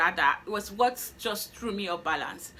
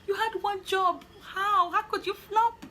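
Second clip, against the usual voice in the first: much higher, laughing half-cry; laugh-cry at your own flop; laughing despair; 10 semitones higher, slower than usual. Retold or imitated. To herself after blowing the interview, high laughing wail. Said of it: "I was like".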